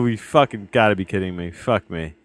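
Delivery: ordinary talk, nothing marked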